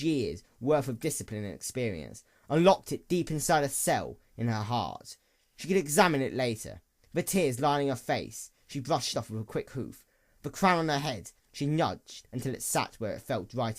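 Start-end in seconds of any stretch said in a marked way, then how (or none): none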